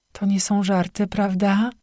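normal talking voice

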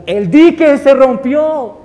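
high, drawn-out cry of alarm; acted town-crier's warning yell; shouting alarm. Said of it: "un hombre que salió gritando".

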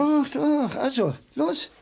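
proud husband snapping back that he will go; defiant insistence. Acted. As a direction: overrule her, proving he can do it. Husband insists on climbing tower.

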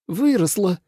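high-pitched, angry hiss; mildly hissed, high retort; furious hiss. A narrator's reading. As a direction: spit the word out crossly. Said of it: "прошипела Суэлань".

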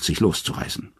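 ordinary delivery, nothing marked

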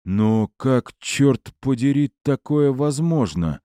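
incredulous, rising and slowing; can't believe what he's hearing; disbelieving. A narrator's reading. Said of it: "отказывался верить в происходящее".

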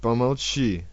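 plain reading voice

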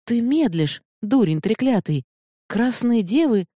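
high, urgent scolding cry; angry. Acted. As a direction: berate him in alarm, pitched high. Eda berates Aralak in alarm.

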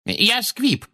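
very high, thin woman's voice; explaining witness. A narrator's reading. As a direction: explain timidly, high-pitched and falling at the end. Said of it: "объяснила миссис Фигг".

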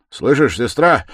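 loud, high, straining call; urgent, effortful appeal; last effort. A narrator's reading. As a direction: summon the last strength to speak. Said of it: "собрав последние усилия".

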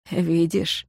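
quiet insistence. A narrator's reading. Ignat presses his suspicion softly.

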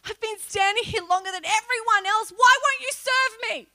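high, loud, desperate plea; retold pleading cry. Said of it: "I said".

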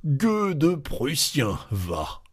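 slow growl between the teeth; raging mutter. A narrator's reading. Loiseau cursing the Prussian officer, muttering angrily. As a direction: grumble it through clenched teeth, mock-furious. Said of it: "il murmurait entre ses dents d'un air rageur".

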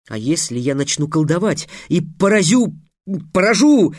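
threat rising to an unsure, high question; faltering threat. A narrator's reading. Trix unsure of his threatening word, faltering. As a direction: test «поражу» aloud as a questioning word. Stumbling over a threatened spell.

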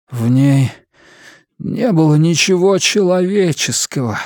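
cracking, strained voice; voice breaking. A narrator's reading. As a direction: let the voice break on it. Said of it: "срывающимся голосом поведал он".

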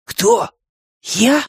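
high, startled questions; taken-aback little hero; choking disbelief. A narrator's reading. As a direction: ask it in high-pitched astonishment. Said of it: "чуть не поперхнулся Бартоломео".

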